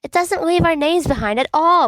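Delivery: high, loud indignant protest. A narrator's reading. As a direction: push back hard, pitch up. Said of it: "protests Remus".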